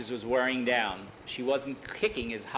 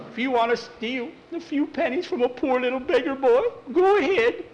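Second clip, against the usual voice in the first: pitiful guilt trip; 11 semitones higher, 5 dB louder than usual. Acted. Skeeter guilt-tripping Tom, pitiful.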